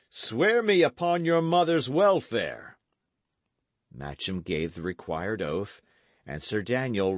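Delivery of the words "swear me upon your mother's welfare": loud, higher stern order; commanding cry. Acted. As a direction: knight demanding a real oath. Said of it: "cried the knight".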